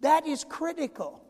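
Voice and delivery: ordinary talk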